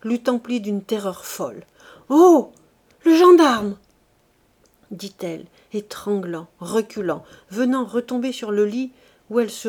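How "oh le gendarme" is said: loud, high cry of terror; terrified cry. A narrator's reading. Old madwoman seeing the gendarme, terrified cry. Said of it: "laissa échapper un cri terrible".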